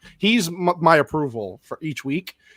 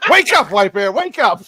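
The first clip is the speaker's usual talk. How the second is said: calling out; 6 semitones higher, 8 dB louder than usual. Real, not acted. Calling out to absent friend.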